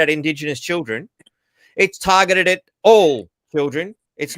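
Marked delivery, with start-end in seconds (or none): 1.8-3.9 emphatic louder line. Real, not acted. Ranting correction of the report.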